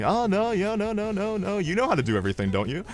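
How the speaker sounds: sarcastic, pitch-swooping string of objections; mock bickering. Coworkers squabble over the computer, sarcastic mimicry. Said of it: "they start going at each other".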